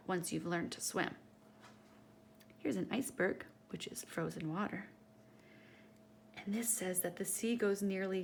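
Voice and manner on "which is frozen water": hushed aside, no voice; whisper. Acted. Reading to a little one, a whispered aside. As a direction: lean in and breathe it like a secret.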